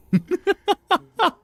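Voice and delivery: rapid staccato high laughter; helpless high-pitched giggling; high cackling laugh. Real, not acted. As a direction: burst into a high, stuttering laugh. Laughs after joking about crying.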